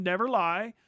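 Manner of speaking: normal talking voice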